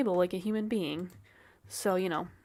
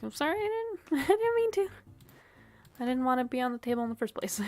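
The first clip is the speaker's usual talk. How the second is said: high, squeaky mock apology to the guards, with a laugh; flustered apology; 4 semitones higher than usual. Player retelling her apology to yelling guards, squeaky and flustered. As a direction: play yourself as a panicked, giggly little voice. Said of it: "I'm like".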